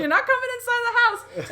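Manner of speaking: high, loud panicked yell; high, loud scream at the intruder outside; panicked shout. Retold or imitated. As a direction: scream it to keep him out. Standing off the intruder, bat raised.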